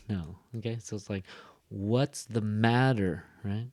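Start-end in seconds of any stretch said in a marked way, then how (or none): 1.7-3.2 very slow, "matter" stronger and longer; stress on matter. Shown as a demonstration. Teacher showing where the emphasis goes, stress on matter. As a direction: stretch and push "matter", then fall. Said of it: "a little bit louder and a little bit stronger".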